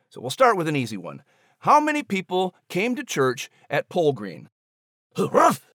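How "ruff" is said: a sharp, higher, louder woof; dog bark. A narrator's reading. Max the dog answers.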